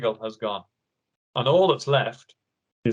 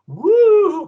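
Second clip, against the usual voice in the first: spooky woo; 9 dB louder than usual. Acted. Spooky spider legs creeping.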